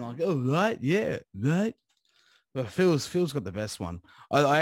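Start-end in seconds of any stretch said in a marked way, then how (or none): 0.2-1.7 mock accent, higher pitched; put-on accent, questioning lilt; Caleb's accent. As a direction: imitate his accent with rising ends. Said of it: "Caleb definitely does have an accent".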